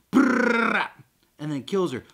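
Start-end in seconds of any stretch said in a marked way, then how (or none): 0.1-0.9 loud rolled gun noise; imitated machine-gun fire. Carl shoots the zombie, loud mouth-made gunfire. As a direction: trill a burst of gunfire.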